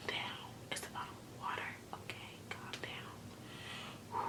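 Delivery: hushed aside; whisper. Real, not acted. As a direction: whisper it to yourself, exasperated. Mocking contestant, muttering to herself.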